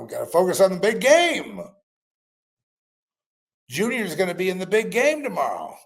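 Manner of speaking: mock excited fan. Audience chatter voiced after film.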